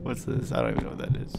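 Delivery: hushed unvoiced whisper. Real, not acted. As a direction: whisper so the monster won't hear. Whispering in dark.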